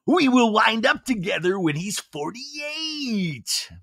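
high, drawn-out mocking coo; mock wishful gushing. Mocking a deluded romantic claim.

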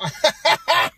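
bursting out laughing; big laugh at his own joke; loud laugh. Real, not acted.

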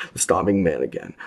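ordinary delivery

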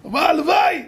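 high, shouted eager reply; eager yes. Retold or imitated. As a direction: burst out with delight at the offer. Youth's eager reply retold. (תשובה נלהבת בצעקה)

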